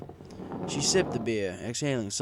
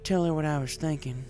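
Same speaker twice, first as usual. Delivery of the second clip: higher mare voice, hesitant and sheepish; sheepish farm mare; 9 semitones higher than usual. A narrator's reading. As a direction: admit it shyly as the mare. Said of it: "she admitted sheepishly".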